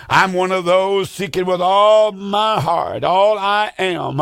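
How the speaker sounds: fervent slow plea. Real, not acted. Preacher pleading with viewers, slow and earnest. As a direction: pour out your heart, slowly. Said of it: "earnest plea, slow".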